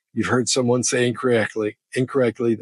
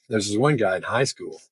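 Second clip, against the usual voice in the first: normal talking voice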